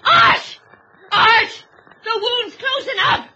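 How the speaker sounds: loud, high alarmed calling; a frightened wife shouting to Arthur for help. Acted. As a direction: call out to him in alarm. Calls Art in alarm.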